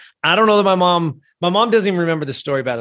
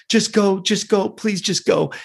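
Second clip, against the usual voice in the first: higher-pitched, repeated urgent plea; retold pleading; 6 semitones higher, slower than usual. Upset boy urges mom to drive off, higher-pitched pleading.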